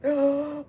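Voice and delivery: sharp playful gasp; mock startled gasp. Teacher pretending fright at an alligator picture, gasp. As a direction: pretend the picture scared you.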